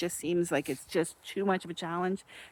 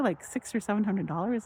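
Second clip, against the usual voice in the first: ordinary talk, nothing marked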